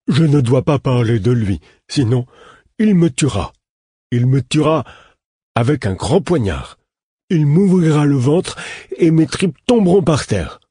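put-on high, loud, panicked childlike voice; a scared man-child blurting out his terror; terrified simple man. A narrator's reading. Simple man to the narrator, afraid of the killer, panicked high voice.

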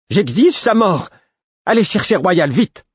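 very high-pitched, urgent command; furious demand. A narrator's reading. Enraged sister ordering Cobb to fetch Royal, furious demand. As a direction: demand it at the top of your register.

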